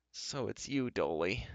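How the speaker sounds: soft, mournful doorkeeper with lilting pitch; sad voice. A narrator's reading. Doorkeeper to unexpected dwarf visitor, sad. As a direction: sigh it out sadly and softly. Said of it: "a sad voice replied".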